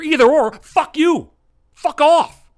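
loud, high, furious shout; angry shout. Real, not acted. Comic yelling at partisan listeners, loud and furious. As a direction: blast it at both parties. Ranting comic telling everyone off.

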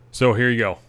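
ordinary delivery

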